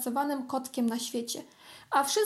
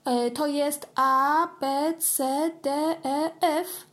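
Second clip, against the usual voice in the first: slow counting; 3 semitones higher, slower than usual. Acted. Kitten counting alphabet.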